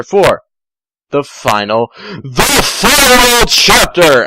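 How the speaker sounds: slow, high, loud the final chapter; dramatic announcement. Acted. Reader hyping the final chapter in a grand voice. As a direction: announce it like a trailer voice.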